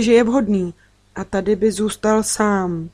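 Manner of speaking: ordinary delivery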